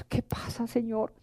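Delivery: very soft, low, almost whispered; hushed question. Retold or imitated. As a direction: ask God under your breath. Retold private question to the Lord. (pregunta en voz muy baja)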